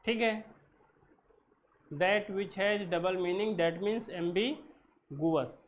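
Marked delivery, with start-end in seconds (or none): 1.9-5.6 slow, spaced-out definition; slow dictation. Shown as a demonstration. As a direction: read it slowly so they can write. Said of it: "teacher dictating definition slowly".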